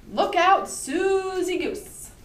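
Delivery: high, loud storybook title with drawn-out Susie; dramatic title call. Acted. Reads title aloud with drama.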